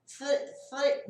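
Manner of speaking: cut-off voiceless "th-" with no force; a weak blown th- that dies; failed TH attempt. Shown as a demonstration. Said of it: "tentar falar três". ("th-" fraco, sem força)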